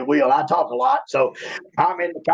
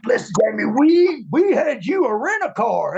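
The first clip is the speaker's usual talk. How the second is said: Jim Ross; 8 semitones higher than usual. Retold or imitated. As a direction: drag it out, fed up. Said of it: "Jim Ross said".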